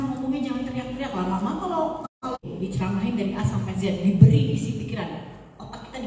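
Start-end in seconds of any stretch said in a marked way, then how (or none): none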